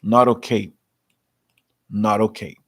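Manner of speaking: "not okay" with the t tapped like a quick r, "naroke"; flapped t like naroke. Shown as a demonstration. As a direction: link "not okay" with a fast D. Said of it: "o nosso ouvido parece um R".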